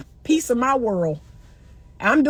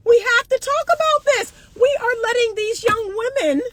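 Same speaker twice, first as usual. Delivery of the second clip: emphatic; 10 semitones higher than usual. Real, not acted. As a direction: press it hard, pitched up.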